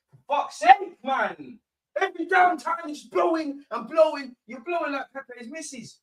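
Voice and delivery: high, exasperated yell at the ref; real fed-up shout; angry outburst. Real, not acted. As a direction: let the frustration explode at the referee. Outburst at the referee.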